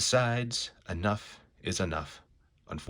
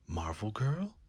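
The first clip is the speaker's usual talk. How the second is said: whisper; 5 dB quieter than usual. Acted. Beast whispers recognition in surprise.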